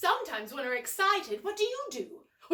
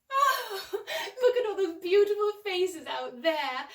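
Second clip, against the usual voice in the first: laughing delight; 5 semitones higher, 5 dB louder than usual. Acted. Praising children's grins.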